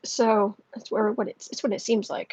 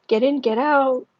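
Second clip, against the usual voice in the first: usual speaking voice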